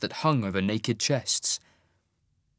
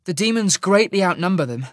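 higher, louder woman's voice, urgent; young woman, urgent; 8 semitones higher, 5 dB louder than usual. Acted. Sasha to the group, a higher urgent report. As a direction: report what you see urgently.

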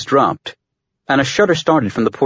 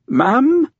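tentative, much higher questioning call; a hesitant ship's computer seeking attention; uncertain AI; 14 semitones higher than usual. A narrator's reading. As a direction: ask it unsurely, pitched well up. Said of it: "uncertainty creeping into its voice".

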